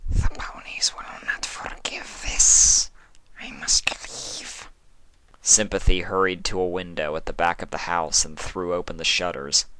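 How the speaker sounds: a seductive hushed question; whispering changeling. Acted. Sympathy whisper voice.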